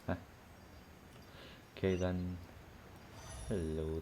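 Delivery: normal talking voice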